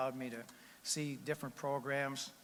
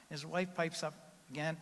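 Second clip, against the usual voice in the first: ordinary delivery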